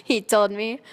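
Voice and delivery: ordinary delivery, nothing marked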